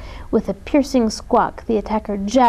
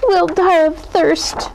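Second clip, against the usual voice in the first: much higher, slow panicked wail; despairing cry; 8 semitones higher, 3 dB louder, slower than usual. A narrator's reading. Columbine fears dying of thirst, despairing cry. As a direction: lament it loudly. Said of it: "cried Columbine".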